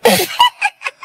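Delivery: sudden high-pitched laugh; high cackle. Acted. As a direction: cackle like a villain. Evil laugh before threat.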